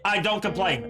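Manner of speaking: ordinary talk, nothing marked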